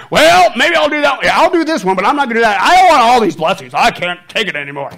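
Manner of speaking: high, loud preaching shout; fired-up sermon shout, mocking excuses; shouting preacher. Real, not acted. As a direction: belt it out to the congregation. Preaching at full force.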